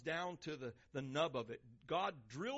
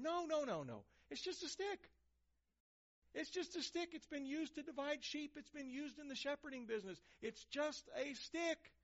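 much higher, playful no-no-no; mock God voice; 9 semitones higher than usual. God telling the congregation it's just a stick, high and playful. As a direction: wave it off lightly, pitched way up. God dismissing the fancy staff.

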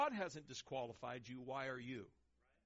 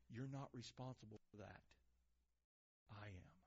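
softer and lower, fading to a hush; reassuring God; 6 semitones lower, 5 dB quieter than usual. Acted. God reassuring the reluctant speaker, softening to a hush. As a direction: promise gently, dropping away.

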